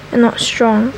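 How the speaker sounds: usual speaking voice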